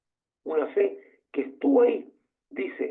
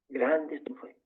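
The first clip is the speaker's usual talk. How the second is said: softer, tender echo of the praise; a softer, tender echo of Jesus' praise; tender repeat; 3 semitones lower, 6 dB quieter than usual. Acted. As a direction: let it sink in gently, softer.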